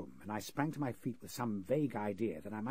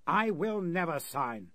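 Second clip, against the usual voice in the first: much higher, louder, slow defiant cry; indignant refusal; 7 semitones higher, 7 dB louder, slower than usual. Acted. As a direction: refuse with outraged pride. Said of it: "the same indignant reply".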